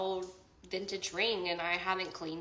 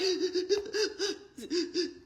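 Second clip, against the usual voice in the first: high teary laugh; a sudden teary laugh breaking out; 11 semitones higher than usual. Real, not acted. Recalling sobbing on the live stream, teary laugh.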